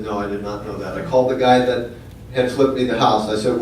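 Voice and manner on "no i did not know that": hushed and shaken, much softer; stunned hush. Retold or imitated. Realtor breaks the news, speaker answers in shocked hush. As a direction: drop to a stunned near-murmur. Told of a violent death.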